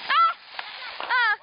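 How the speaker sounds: high startled cries; startled yelp. Real, not acted. Kid almost hit by a firework, startled yelp.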